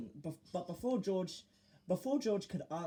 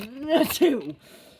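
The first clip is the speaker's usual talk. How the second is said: sneeze; 11 semitones higher, 16 dB louder than usual. Acted. Sneeze breaks off mid-word.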